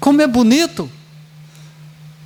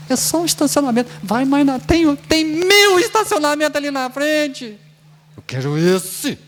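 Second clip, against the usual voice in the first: mock pushy driver; 6 semitones higher than usual. Pushy driver in parking lot.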